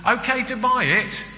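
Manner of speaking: high, wheedling ask; coaxing wife. Acted. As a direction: play the wife sweetly asking. Wife asking permission.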